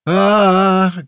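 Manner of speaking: high, loud whimpering cry; drawn-out wail of pain. A narrator's reading. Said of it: "Grell whimpered again".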